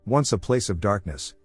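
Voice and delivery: ordinary delivery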